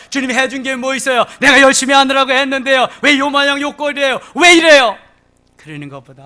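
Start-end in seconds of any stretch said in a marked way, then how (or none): 0.1-5.0 high, loud griping; complaining to God. Acted. Preacher voicing a believer grumbling at the Lord, whiny. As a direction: whine at the Lord.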